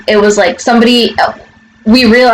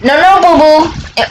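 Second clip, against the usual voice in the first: higher, quick warning to a pet; 6 semitones higher than usual. Real, not acted. Stopping Boo Boo getting these, high warning. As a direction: cut in with a high no. Stops pet grabbing things.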